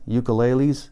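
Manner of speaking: plain reading voice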